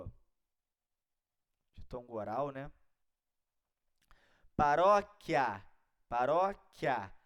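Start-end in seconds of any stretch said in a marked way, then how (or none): 4.5-7.1 paróquia slowly, loud RÓ, clear quia ending; stress on RÓ. Shown as a demonstration. Teacher demonstrating a paroxytone, slow paróquia with strong RÓ. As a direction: punch and stretch the RÓ in paróquia. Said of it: "paroxítona terminada em ditongo".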